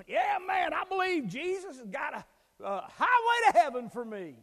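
loud mocking caricature of easy faith; mock bravado. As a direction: ham up the cocky churchgoer.

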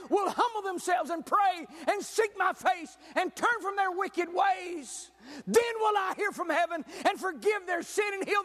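high, forceful pulpit cry; full-force sermon delivery, pitched high; impassioned preaching. Acted. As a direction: preach it out with urgent, ringing intensity.

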